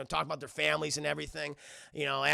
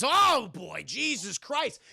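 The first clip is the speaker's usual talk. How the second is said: higher, exclaimed alarm; mock dismay; 5 semitones higher, 4 dB louder, much slower than usual. Cop reacting to the feds being called in, mock dismay. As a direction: act out a cop's exasperated dismay.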